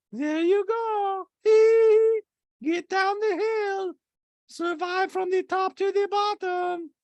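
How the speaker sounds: very high, slow, stretched caricature; Diana. Retold or imitated. Podcaster mimicking Diana's talking head, hammed-up slow squeal. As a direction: draw each phrase out in a squeaky mock voice.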